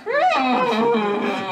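high, loud dolphin squeal; dying dolphin. Pretend. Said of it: "Dying dolphin".

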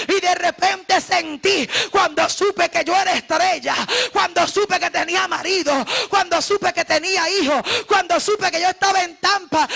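fiery Spanish preaching; preaching at full force. Acted. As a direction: pour it out like a revival sermon.

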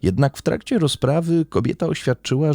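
ordinary delivery, nothing marked

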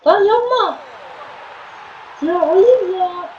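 high, loud, drawn-out protest; frustrated outcry. Real, not acted. Gamer protesting a bad play, high loud frustrated cry. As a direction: cry out as the play goes wrong.

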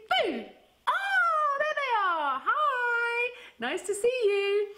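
high playful peekaboo; excited peekaboo. Pretend. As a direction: squeal hello to the hands. Peekaboo reveal.

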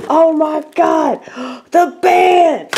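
thrilled high shout; excited shout. Real, not acted. Finds The Band CD.

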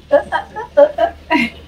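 loud high laugh; happy laugh. Real, not acted. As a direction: burst out laughing. Laughing about anniversary.

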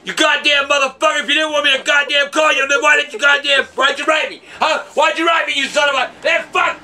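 shouting curses in a mounting fury; furious rant. Acted. Santa yelling at Jeremy who wrote asking for a call, furious. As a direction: blast the kid for writing to Santa.

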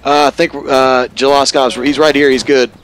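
shouting. Real, not acted. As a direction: yell the status report over the noise. Reporting casualty status in combat.

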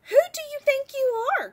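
high, loud and fast; indignant cry. Acted. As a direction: snap back in outrage. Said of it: "cried the rainbow fish".

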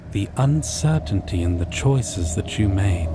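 usual speaking voice

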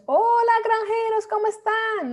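high, loud hail across the fence; exaggerated, high-pitched shouted hello; mock neighbourly greeting. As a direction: call out cheerily to the farmers. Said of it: "decir a tus vecinos".

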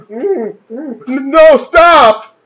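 high, loud, very slow mock whimper; hammed-up panicked no, stop; mocking the wimpy hero. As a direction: ham up a scared protest. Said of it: "It's like".

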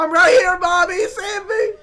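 high, loud tearful shout; hammed-up terrified wail; panicked child crying out. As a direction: scream for rescue like a small child. Child answers mother's call.

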